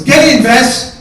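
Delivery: high, loud command; urgent yell. Retold or imitated. Brother in the car calling her in, yelling urgently. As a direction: bellow the order.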